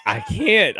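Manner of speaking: ordinary delivery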